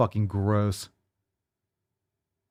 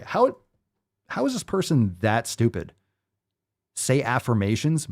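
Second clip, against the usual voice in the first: normal talking voice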